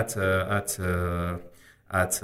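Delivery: halting, drawn-out stammer with long pauses. Acted. Flustered candidate answering the examiner about arrival time, stammering. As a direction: get stuck on the word, stalling. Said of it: "به تته پته بیفتیم".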